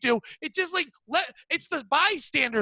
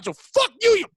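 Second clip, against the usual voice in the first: mock fury. Angry rant about wrong bagel.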